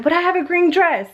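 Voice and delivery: imitating a child's high-pitched protest; mock whine, pitched well up; crying daughter. Daughter pleading with mom over her outfit, imitated whine. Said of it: "she's in here crying".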